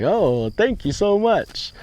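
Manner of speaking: high, slow, overly grateful thanks; gushing thanks. Retold or imitated. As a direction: play the eager kid who doesn't get the compliment. Naive thanks to the boss.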